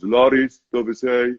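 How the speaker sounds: deadpan, emotionless line reading; no emotion. Retold or imitated. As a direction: say it without any feeling. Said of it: "with no emotion whatsoever".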